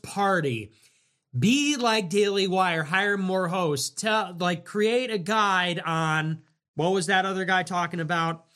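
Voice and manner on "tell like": ordinary delivery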